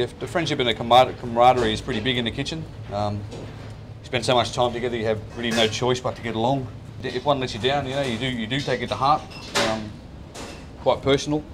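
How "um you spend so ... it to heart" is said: usual speaking voice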